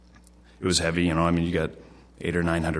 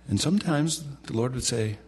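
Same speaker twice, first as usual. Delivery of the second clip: ordinary talk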